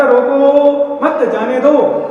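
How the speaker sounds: रोको drawn out, then मत जाने दो slowly; word-for-word recall. Retold or imitated. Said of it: "एक एक शब्द याद है मुझे". (रोको, मत जाने दो, शब्द खींचकर धीरे-धीरे)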